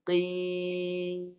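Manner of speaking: "Qim" chanted long into a nasal m; out through nose. Shown as a demonstration. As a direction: let the drawn-out "Qim" resonate through the nose. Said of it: "Keluar kat hidung".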